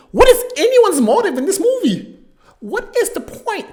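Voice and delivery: high, laughing shout of disbelief; exasperated outcry. Real, not acted. Reviewer asking viewers about the motives, exasperated high outcry.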